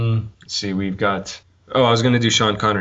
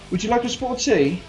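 high posh English accent test line; English accent warm-up line, pitched well up; English guy; 12 semitones higher than usual. Shown as a demonstration. To himself in private, trying an English accent. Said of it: "if I wanna do an English guy".

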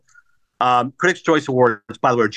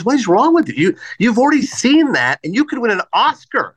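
incredulous retold outburst, higher voice; Howard Stern; 8 semitones higher than usual. Radio host scolding a movie star's choice, imitated high incredulity. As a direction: stretch it out in mock disbelief. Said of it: "And Stern goes".

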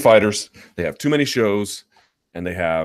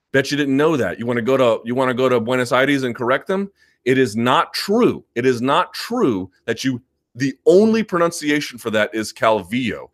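ordinary delivery

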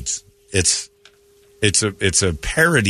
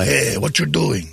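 slow gangster line; 4 semitones higher, slower than usual. Pretend. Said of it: "You mean like".

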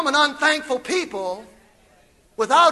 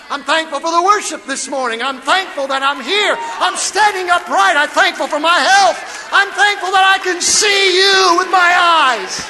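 higher, louder shouted praise; preaching at full force; 5 semitones higher, 7 dB louder than usual. Real, not acted. Pastor shouting his thanks before the congregation, full force. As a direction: build to a jubilant shout.

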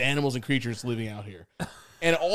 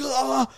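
high imitated wail; mock panicked cry; 10 semitones higher than usual. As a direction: imitate a flailing friend's helpless cry. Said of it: "he's like".

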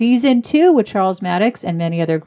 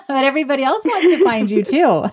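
laughing; 6 semitones higher than usual. Real, not acted. Host laughing at the guest's joke.

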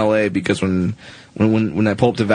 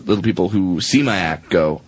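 ordinary delivery

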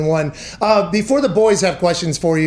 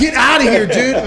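laughing mock boast; 4 semitones higher, 3 dB louder than usual. Real, not acted. Repeats the laughing brag.

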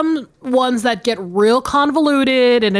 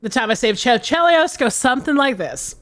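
usual speaking voice

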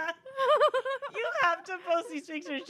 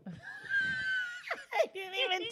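squealing high through laughter; can't get words out for laughing; shrieking laugh; 8 dB quieter, much slower than usual. Real, not acted. Friend bursts out at photo.

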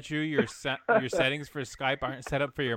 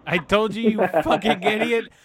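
exasperated yell; 10 semitones higher, 4 dB louder than usual. Real, not acted. Scolding friend over mic.

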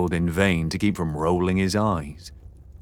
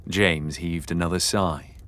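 ordinary delivery

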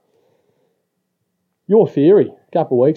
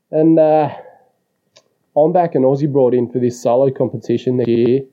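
usual speaking voice

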